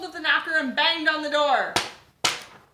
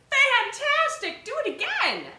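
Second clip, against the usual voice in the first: loud, much higher, slow cheer; shout; 12 semitones higher, 6 dB louder than usual. Acted. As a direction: cheer him on to go again. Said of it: "but Elizabeth shouted".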